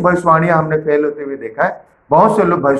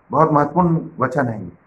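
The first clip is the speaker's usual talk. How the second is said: usual speaking voice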